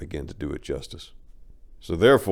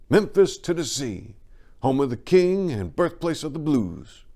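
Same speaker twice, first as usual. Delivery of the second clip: proud; 8 semitones higher than usual. Retold or imitated. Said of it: "with my head held high".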